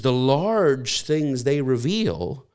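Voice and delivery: drawn out slowly, each word weighed; slow emphasis. Shown as a demonstration. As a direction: linger on the phrase to let it sink in. Preacher repeats phrase for weight.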